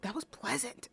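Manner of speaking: soft, deadpan take of the teacher's words; quiet imitation. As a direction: say it softly, deadpan. Teacher's line retold once more.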